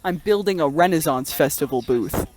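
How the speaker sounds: usual speaking voice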